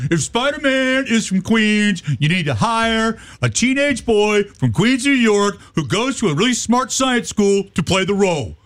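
high-pitched pretend protest speech; mock protester. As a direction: rant like a hammed-up picketer. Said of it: "I can see the protesters now".

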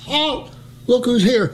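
high repeated sentry shout; high mock shout. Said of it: "I says".

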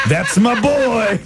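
high, drawn-out proud cheer, laughing; mock proud dad. Co-host joking as the caller's dad, mock proud. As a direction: draw it out like a hammed-up proud father.

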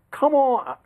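high, exclaimed sportscaster complaint; retold on-air outcry, pitched high; exasperated protest. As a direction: protest a terrible call. Retells protesting a bad call on air.

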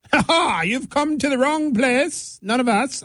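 high, drawn-out British royal gloating; mock British royalty. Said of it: "making the Incas and the Mayas sound like British royalty".

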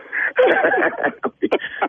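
a long, high stretch of laughter. Real, not acted. Still cracking up at the radio prank, laughing hard. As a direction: keep laughing at being fooled.

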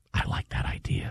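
soft breathy whisper. Pretend. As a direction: whisper it like a podcast host. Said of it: "what if we whisper".

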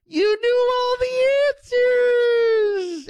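very high, drawn-out mock scream; screaming baby talk. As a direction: imitate a shrieked, slow baby-talk cheer. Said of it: "talks to him in baby talk ... screaming at the top".

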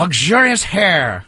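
very slow put-on Korean accent; comic slow Korean accent; mock Korean accent. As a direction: drag luxurious hair out in a hammed-up Korean accent. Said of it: "Do a Korean from".

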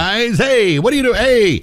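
Mike Rattay uncomfortable. Retold or imitated. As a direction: imitate an uneasy guy pleading with his pals. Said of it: "And Mike would say".

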